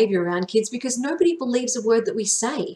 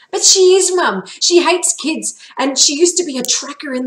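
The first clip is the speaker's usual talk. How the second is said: much higher, much louder insistence; protesting; 7 semitones higher, 7 dB louder than usual. A narrator's reading. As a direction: argue back hard to Mum. Kid protests to Mum.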